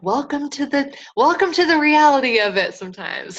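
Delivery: high-pitched, wry retold reply; mock welcome. Replying to sister's lost order, high mock welcome.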